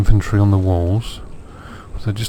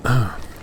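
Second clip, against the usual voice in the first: short throat-clear; quick cough to clear the throat; 2 semitones higher, 4 dB quieter than usual. Real, not acted.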